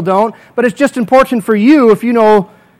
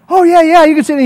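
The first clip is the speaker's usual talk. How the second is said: eager yes; 6 semitones higher, faster than usual. Pretend. Proud owner answers pastor.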